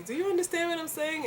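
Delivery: high, patronizing question. Retold or imitated. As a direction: mimic someone talking down. People asking her repeatedly.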